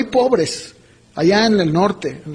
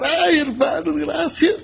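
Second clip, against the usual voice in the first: high mock-weepy voice; imitated tearful thanks; 7 semitones higher, slower than usual. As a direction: imitate a woman bursting into grateful tears. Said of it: "soltó a llorar". (agradecimiento lloroso imitado, en voz aguda)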